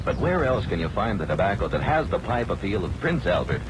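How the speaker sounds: ordinary delivery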